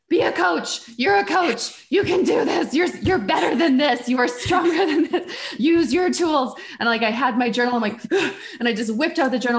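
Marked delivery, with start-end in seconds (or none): 0.1-6.5 a coach yelling encouragement at herself; self pep talk. Retold or imitated. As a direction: shout yourself into gear, laughing at the end. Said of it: "I pivoted and I was like".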